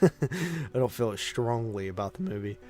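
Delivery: usual speaking voice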